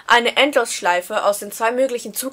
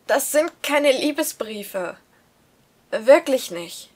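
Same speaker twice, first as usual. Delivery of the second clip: slow insistent denial; 3 semitones higher, slower than usual. Acted. Son denies love letters.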